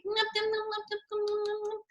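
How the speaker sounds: high, soft nom-nom munching; child's high, quiet gobbling sounds; eating noises. Acted. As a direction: slurp it up contentedly. Penguin keeps drinking.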